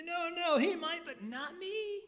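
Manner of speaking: high-pitched mock denial, pointing at others; hammed-up "not me" boast; boastful disciple. Disciple denying, voiced.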